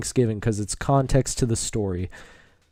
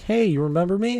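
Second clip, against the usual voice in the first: put-on eager kid; 8 semitones higher, faster than usual. Imitating kid greeting him.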